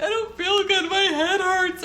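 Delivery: mother imitating her sick son; sick son's complaint. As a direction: whimper like a kid with a headache. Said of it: "He's like".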